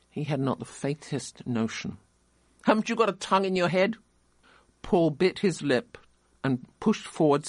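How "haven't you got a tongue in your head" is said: impatient scolding. A narrator's reading. Cashier scolds the boy.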